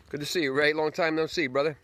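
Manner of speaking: greeting a neighbor from a distance, calling out. Real, not acted. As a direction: hail a neighbor across the yard. Greeting neighbor Ray outdoors.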